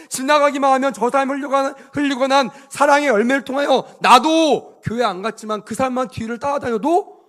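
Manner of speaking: loud, rising preacher's exhortation; forceful preaching. Real, not acted. Preacher urging the congregation to bear fruit, forceful. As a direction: push each phrase out to the congregation.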